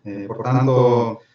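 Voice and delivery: Portuguese with a strong Italian accent; Italian speaker mixing in Italian words. Real, not acted. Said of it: "io sono italiano e falo un portoghese, un poco macaronico".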